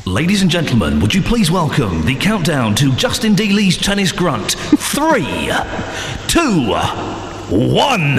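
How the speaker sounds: slow, drawn-out mock announcer countdown; hammed-up ceremonial introduction; grand announcer. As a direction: build it up like a big event.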